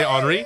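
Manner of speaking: "Henri" said as on-REE; French Henri. Acted. Host teasing cohost as French lady, "Henri" said French-style. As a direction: drop the H in "Henri". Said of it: "suddenly a little French lady".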